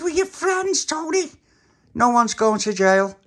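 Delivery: high, loud, slow insisting; high insistence. Acted. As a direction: loudly reassure the stuck friend. Reassuring panicking Tony.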